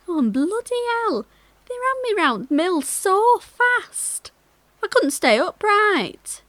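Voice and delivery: high, excited anecdote voice; animated retelling. Acted. Mill worker retells being raced round in a basket, animated. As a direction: relive the mishap with rising, high-pitched excitement.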